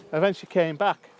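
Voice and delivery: ordinary talk, nothing marked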